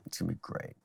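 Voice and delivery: whispered tease, very soft; playful whisper to build suspense. Real, not acted. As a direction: breathe it out as a secret promise. Teasing whisper before telling story.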